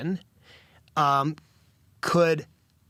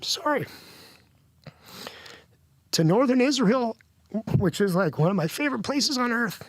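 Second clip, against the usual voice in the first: holding back tears, pitched up; real emotion pushing the voice higher; emotional, voice rising; 7 semitones higher than usual. Real, not acted. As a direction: voice climbing high with emotion. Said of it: "I didn't wanna get emotional".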